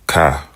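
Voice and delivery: Ka with a crisp stopped k; hard kaf. Shown as a demonstration. Teacher contrasts the hard kaf for learners, crisp Ka. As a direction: snap Ka off cleanly. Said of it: "They call this the hard kaf".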